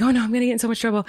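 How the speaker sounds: retold panicky rush; panicking. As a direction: blurt it fast, as if panicking. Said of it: "And I start panicking".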